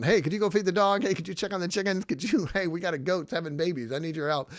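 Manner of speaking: parent asking a favour. Retold or imitated. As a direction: call out casually to the grown kids for help. Parent calling on grown kids.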